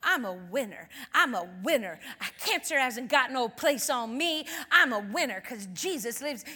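growing bold, punched declaration; emphatic, swaggering testimony; preaching that verse. Acted. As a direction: preach it with rising conviction. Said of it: "she started preaching that verse".

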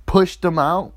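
ordinary delivery

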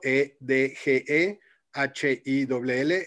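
letra por letra, despacio; spelling it out. Shown as a demonstration. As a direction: deletreando E D G E H I W L con pausas. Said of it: "así todo junto". (deletreando despacio)

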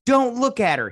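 high, urgent hissed-up warning; high mock warning to Larry. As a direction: squeal it like a panicked friend. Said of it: "he's like".

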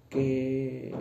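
ordinary delivery

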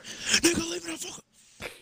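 very high mock squeal; exasperated squeal. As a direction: squeak it out at a pest. Retold outburst at pestering friend.

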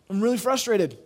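voiced-up exasperation of someone else; retold frustration. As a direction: push it up as a friend venting. Fired friend venting, retold.

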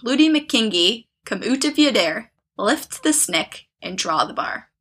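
slow, chanted rhyme in a clumsy Scottish accent; halting Scottish-spelling singsong, deliberately drawn out; bad Scottish accent. Shown as a demonstration. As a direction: read the rhyme slowly in a badly faked Scottish accent. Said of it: "I can't do a Scottish accent".

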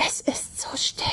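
hushed, voiceless storybook whisper. A narrator's reading. Charlotte to Ben on the silent snowy lake, whispering. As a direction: whisper it very softly. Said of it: "flüsterte Charlotte".

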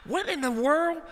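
high, baffled exclamation; high-pitched disbelief; startled. Retold or imitated. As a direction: ask it as if you can't believe your ears. Reacting to neighborhood screaming.

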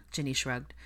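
ordinary delivery

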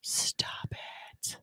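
breathy whisper, pleading; whispered stop. Real, not acted. Co-host begs the host to quit: real whisper. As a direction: hiss it under your breath.

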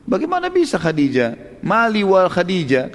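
can't believe what he's hearing; incredulous surprise. Retold or imitated. Prophet astonished at the proposal. (Nabi heran tak percaya, nada tinggi)

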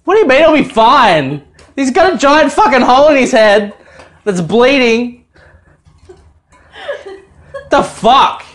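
high, loud outraged protest; retold indignant outburst. Dad retelling his reply to the nurse lady, indignant outburst. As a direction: protest in disbelief, acting it out.

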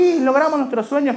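put-on high childish hope; inner child. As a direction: chirp it like a dreamy boy. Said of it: "inner child talking".

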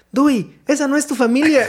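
high, quoted movie line; movie quote. Retold or imitated. Amigo le cita la película al ver al niño, voz alta. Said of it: "Y le digo".

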